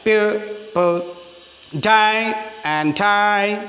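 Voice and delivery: very slow chant, each "die" stretched long and stressed; stress on pure, die. Shown as a demonstration. Teacher breaks the poem line into syllables, long heavy stress on "die". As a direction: stretch out "pure" and both "die", keep "and" weak. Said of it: "ഡൈ എന്നതിനും stress കൊടുത്തു".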